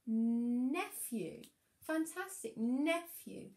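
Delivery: excited praise. Acted. Praising the child's answer.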